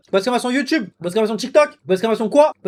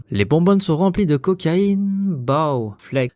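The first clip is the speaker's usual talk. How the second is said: slow, lower rapper imitation; 6 semitones lower, much slower than usual. Streamer testing his impression on viewers, slow low rapper voice. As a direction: stretch the line out low, like a drill rapper. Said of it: "la seule imitation ... c'est [name]".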